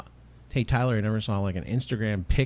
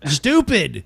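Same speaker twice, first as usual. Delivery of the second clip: loud, higher-pitched burst of self-disgust; mock exasperation; 6 semitones higher, 8 dB louder than usual. Host sick of hearing his own intro, mock exasperated. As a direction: burst out, hammed-up annoyance. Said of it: "I'm like".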